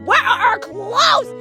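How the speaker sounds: gasping, shocked high cry. Acted. Gasp then shocked shout.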